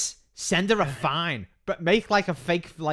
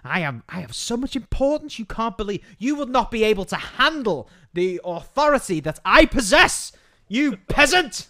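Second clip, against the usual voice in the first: rising, louder, grandiose boast; pompous admin; 5 semitones higher, 5 dB louder than usual. Pretend. Friend voices the admin scolding the woman, building louder. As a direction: build to a haughty sneer. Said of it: "You go".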